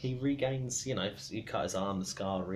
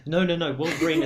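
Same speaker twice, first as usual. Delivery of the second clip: higher, louder protest; 7 semitones higher, 12 dB louder than usual. Real, not acted. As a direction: cut them off loudly. Cutting off a misunderstanding.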